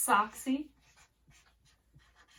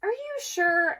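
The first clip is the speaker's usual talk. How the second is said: doubtful rooster; 9 semitones higher, 4 dB louder than usual. Acted.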